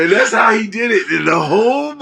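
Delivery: high, fast excited shout. Real, not acted.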